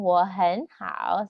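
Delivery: "Wo hen hao" slow and clear; slow example. Shown as a demonstration. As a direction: model the example.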